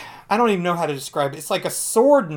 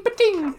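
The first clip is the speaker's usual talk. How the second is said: much higher pa-ting zap; zap gun sound; 3 dB louder than usual. Retold or imitated. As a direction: mimic the ray gun. Imitating zapping guns again.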